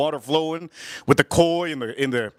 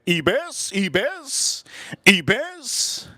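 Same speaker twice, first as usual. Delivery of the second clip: higher, put-on chant; mimicking the father; 6 semitones higher, much slower than usual. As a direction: echo the dad's word in a raised voice. Teller mimics the father's word back.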